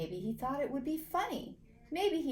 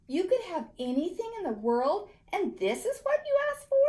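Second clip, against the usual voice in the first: put-on incredulous girl; 9 semitones higher, 5 dB louder than usual. A narrator's reading. Rosie incredulous.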